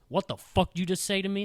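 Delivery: angry challenge. Retold or imitated. Teacher confronts the student who tripped him, angry challenge.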